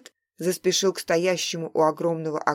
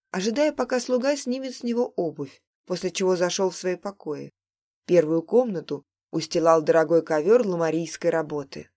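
ordinary delivery

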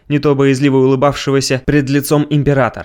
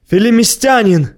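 breathless high shout; urgent panting report; 8 semitones higher than usual. Acted. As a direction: gasp it out, out of breath. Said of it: "запыхавшись, крикнул ему обершталмейстер".

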